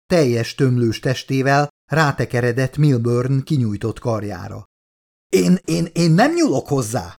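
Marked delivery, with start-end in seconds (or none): 5.3-7.1 raised, higher-pitched protest; panicked yell. A narrator's reading. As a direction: blurt it out in panicked refusal. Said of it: "ordította Fifield".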